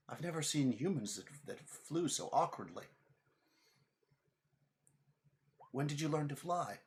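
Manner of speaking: high-pitched princess voice; puzzled princess. Acted. Princess to a clumsily flying human, high puzzled questioning. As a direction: ask in high, puzzled wonder.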